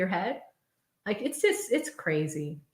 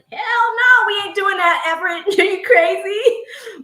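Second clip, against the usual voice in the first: much higher, loud laughing refusal; emphatic refusal; 10 semitones higher, 8 dB louder than usual. Real, not acted. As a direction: shout it down, no way. Refusing viewer request to show license.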